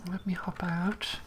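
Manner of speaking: whispering. Real, not acted. Quietly leaving the game session.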